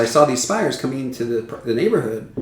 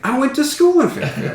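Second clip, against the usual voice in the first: excited quote; 13 semitones higher than usual. Retold or imitated. Roger's excited reply, laughing.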